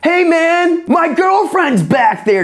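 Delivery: lightly played slacker rocker; Wayne's World quote. Wayne's World guys backstage talking to Walken, dopey and high. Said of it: "It's kinda like Wayne's World when they're like".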